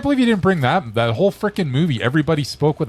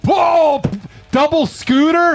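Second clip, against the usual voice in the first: excited; 12 semitones higher, 4 dB louder, slower than usual. Real, not acted. Excited idea of a double scooter.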